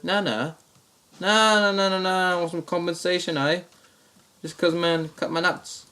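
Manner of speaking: high-pitched Australian protest; Australian prank caller, voice jumping up; Australian accent. Retold or imitated. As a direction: object in a put-on Aussie voice. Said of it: "the same Australian accent".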